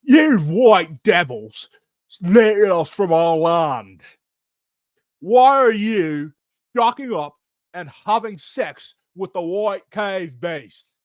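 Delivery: put-on loud, drawn-out British-accented street preacher; slow, declaiming fake British accent; mock British accent. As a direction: stretch each word in a hammed-up British accent.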